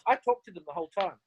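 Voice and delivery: ordinary talk, nothing marked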